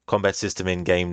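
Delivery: ordinary talk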